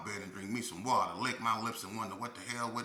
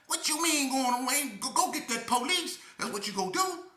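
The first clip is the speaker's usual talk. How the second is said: high yell; 13 semitones higher, 4 dB louder than usual. Retold or imitated. Shouting back retold.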